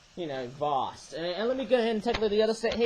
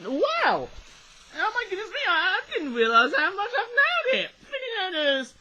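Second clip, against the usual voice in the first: excited; 13 semitones higher, 3 dB louder than usual. Real, not acted. Delighted with his ninja voice.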